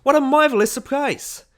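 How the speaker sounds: very high, loud shriek of welcome; screaming greeting. A narrator's reading. Party hostess greets Ned across the crowd, screaming. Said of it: "she began to scream".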